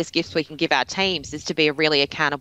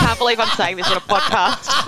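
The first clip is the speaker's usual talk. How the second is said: laughing; 5 semitones higher than usual. Real, not acted. Laughs at own confession.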